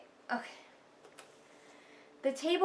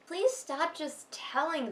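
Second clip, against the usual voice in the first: pleading; 6 semitones higher, slower than usual. Real, not acted. Pleading with the author.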